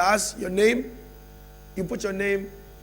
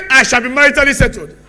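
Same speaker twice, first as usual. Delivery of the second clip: loud, higher, ringing confession; confident declaration; 4 semitones higher, 14 dB louder than usual. Real, not acted. Pastor leads a believing confession, loud and sure. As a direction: proclaim it as if you believe it.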